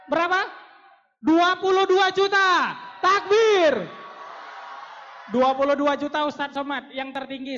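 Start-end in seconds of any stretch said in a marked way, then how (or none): 3.0-3.7 calling out. Real, not acted. Calls crowd to shout takbir.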